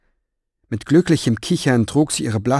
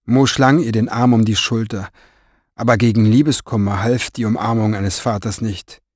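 ordinary delivery

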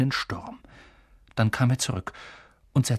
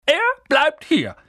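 wütender, hoher, lauter Ausruf; zornig; 14 semitones higher, 6 dB louder than usual. A narrator's reading. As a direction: Onkel Vernon faucht drohend los. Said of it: "doch sehr zornig aussehend". (Onkel Vernon schreit Hagrid zornig an)

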